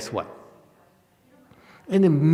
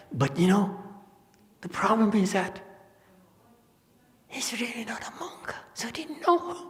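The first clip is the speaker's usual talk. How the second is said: hushed aside; 9 semitones higher, 5 dB quieter than usual. Retold or imitated. Monk quoted confiding a secret.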